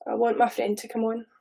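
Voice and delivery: usual speaking voice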